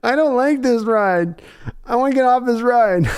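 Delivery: high mock-frightened rollercoaster rider; mock fear. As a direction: play scared on a ride.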